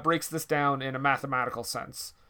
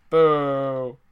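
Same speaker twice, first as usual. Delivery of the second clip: mock boo; 7 dB louder than usual. Mock booing at cut scenes.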